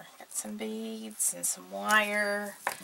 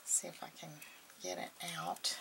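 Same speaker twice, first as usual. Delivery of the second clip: normal talking voice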